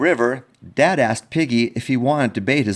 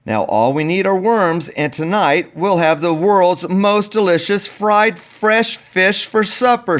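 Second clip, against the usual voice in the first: lightly voiced dad; 5 semitones higher, 4 dB louder than usual.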